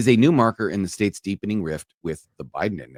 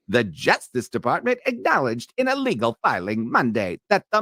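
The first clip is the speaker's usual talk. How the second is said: put-on old-timey radio announcer; 6 semitones higher than usual. Shown as a demonstration. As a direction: read it like an old radio newsman. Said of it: "old-timey radio, old-timey radio announcer".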